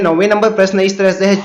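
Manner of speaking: usual speaking voice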